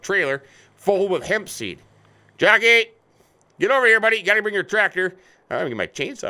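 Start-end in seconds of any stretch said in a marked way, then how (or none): 2.4-5.1 higher, louder call across the farm. Real, not acted. Stuck driver calling the neighbour to bring his tractor. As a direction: holler for him to come over.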